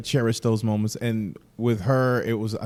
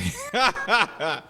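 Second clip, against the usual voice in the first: hearty laugh; 11 semitones higher than usual. Real, not acted. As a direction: crack up laughing. Amused by the retold remark.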